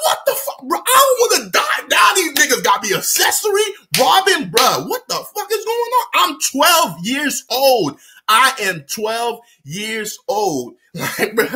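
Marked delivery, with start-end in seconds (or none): 0.1-6.1 high-pitched acted panic; panicked. Kid facing an armed tattoo artist, panicked. 6.1-10.8 put-on very slow, spelled-out "twelve years old"; emphatic protest. Kid protesting robbery involvement, slow emphatic "twelve years old". Protesting his age, acted.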